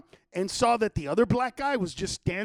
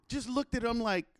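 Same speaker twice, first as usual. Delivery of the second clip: usual speaking voice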